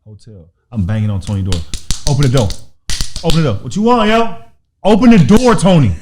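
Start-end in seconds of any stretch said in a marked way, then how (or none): 2.1-5.9 loud, rising urgent calls; frantic calling. Retold or imitated. Banging on Tony's door as firemen come, loud and frantic. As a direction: pound on the door and shout.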